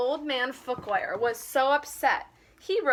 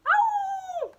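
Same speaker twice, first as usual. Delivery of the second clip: sharp, much higher furious cat screech; cat's yowl; 3 dB louder than usual. Acted. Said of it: "Muffins let out a furious yowl".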